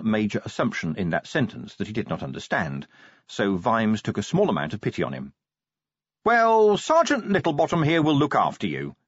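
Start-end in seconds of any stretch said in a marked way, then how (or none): 6.2-9.0 loud, high put-on cheer; hearty welcome, badly faked; fake joviality. A narrator's reading. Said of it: "he said with fake joviality".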